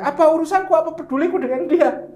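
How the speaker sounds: high, indignant jealous wife voice; Aisyah's inner grumble. Retold or imitated. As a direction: grumble like a jealous wife who won't give way. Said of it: "Ibunda kita".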